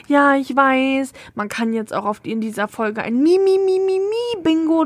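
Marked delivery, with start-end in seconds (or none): 3.2-4.8 mock singsong. Mocking "Mi" singsong.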